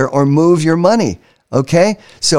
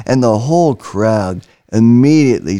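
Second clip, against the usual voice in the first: normal talking voice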